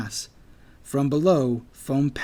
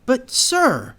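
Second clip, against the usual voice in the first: high, loud, indignant objection; protesting; 11 semitones higher, 5 dB louder than usual. A narrator's reading. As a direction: plead back in protest, pitched up. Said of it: "the J protested".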